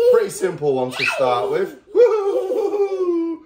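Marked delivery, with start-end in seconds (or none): 1.9-3.5 excited hooting. Real, not acted. Playful hooting at dog.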